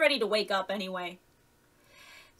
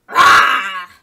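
loud snarling roar; sudden wolfish "rah" at full volume; 2 semitones higher, 17 dB louder than usual. A narrator's reading. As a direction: snarl like a boy playing wolf. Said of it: "the boy snarled".

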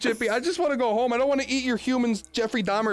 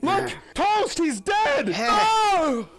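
alarmed shout; 9 semitones higher, much slower than usual. Acted. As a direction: yell out the discovery. Discovering a body.

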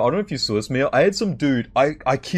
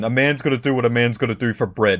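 ordinary delivery

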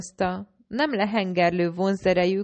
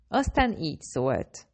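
ordinary delivery, nothing marked

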